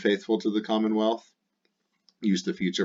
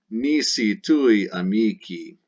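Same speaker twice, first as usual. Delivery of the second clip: slow modelling; 2 semitones higher, 5 dB louder, slower than usual. Shown as a demonstration. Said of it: "teacher reads Latin slowly".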